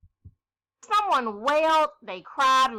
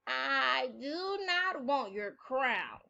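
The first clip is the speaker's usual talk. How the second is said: slow frog voice; 5 dB quieter, much slower than usual. Acted. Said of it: "the frog said".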